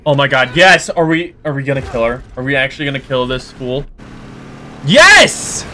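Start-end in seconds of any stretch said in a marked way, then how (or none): 4.8-5.7 high, loud cheer; triumphant yes. Real, not acted. Gamer cheering about to beat Roxy. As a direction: cheer the win.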